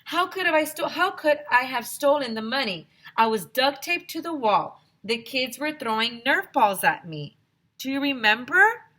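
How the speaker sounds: high, pleading whine. A narrator's reading. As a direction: beg them to believe you. Klutz pleads with police.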